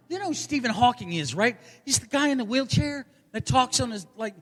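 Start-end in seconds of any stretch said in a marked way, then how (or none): none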